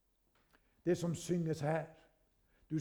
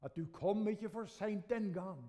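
prayer. Real, not acted. Closing prayer.

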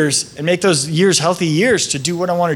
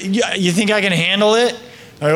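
ordinary talk

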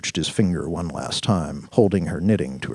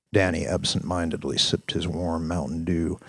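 ordinary talk

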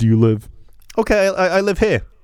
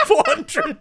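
high, laughing "Four hundred?"; laughing disbelief. Real, not acted. Laughing at the mistaken number, giggling. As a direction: laugh at the slip while asking.

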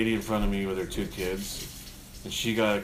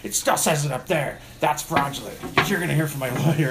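high, loud imitated tirade; hammed-up indignant threat, laugh breaking in; mock irate customer; 5 semitones higher, 5 dB louder than usual. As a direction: argue like an outraged customer. Retold irate customer arguing over sign.

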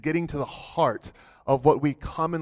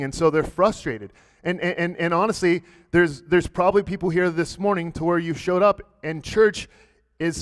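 fervent preaching; 3 semitones higher, 5 dB louder than usual. Acted. Preaching at full force.

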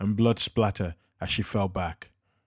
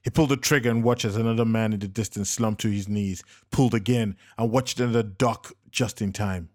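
plain reading voice

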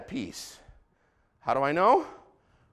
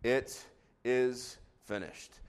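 last words; 11 semitones lower, 13 dB quieter, much slower than usual. Acted. Quoting Jesus's last words.

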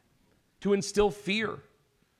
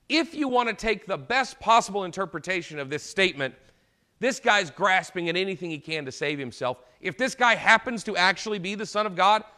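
ordinary talk, nothing marked

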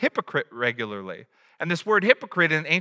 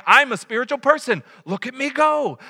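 higher, louder, showing off; mock boast to God; 7 semitones higher, 4 dB louder than usual. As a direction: preen as if God must applaud. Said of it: "Deceiving God and saying".

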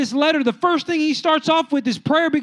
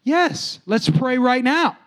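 emphatic insistence; slower than usual. Retold or imitated. Friend insisting they pray on the spot, firm and deliberate. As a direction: answer as if it were obvious. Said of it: "And he goes".

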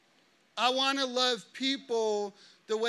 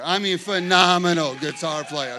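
emphatic praise; 3 semitones lower, 10 dB louder than usual. Real, not acted. Praising Preston to the crowd.

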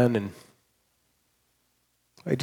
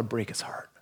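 whispered, softer confession; 3 semitones lower, 11 dB quieter than usual. Real, not acted. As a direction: drop to a hushed whisper. Tender confession in sermon.

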